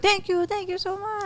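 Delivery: high and soft, gushing thanks; syrupy customer gratitude, softly; mock grateful customer. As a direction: play the sugary diner thanking you. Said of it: "And sometimes, like".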